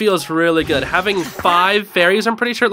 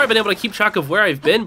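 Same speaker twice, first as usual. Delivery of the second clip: normal talking voice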